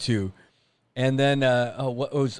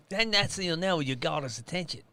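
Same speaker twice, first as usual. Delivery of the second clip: high, softer Australian accent; 6 semitones higher, 6 dB quieter than usual. Shown as a demonstration. Wildlife guide giving croc advice, Australian accent. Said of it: "my go-to with that is".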